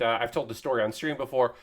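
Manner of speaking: usual speaking voice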